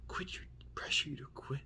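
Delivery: whispered imitation of muttering to himself; talking to himself. Manager mumbling to himself while conjuring up a lie, imitated in a whisper. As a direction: mimic him puzzling it over in a voiceless whisper. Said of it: "Like he was talking to himself".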